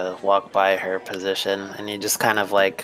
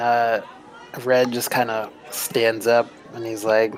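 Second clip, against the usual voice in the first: ordinary talk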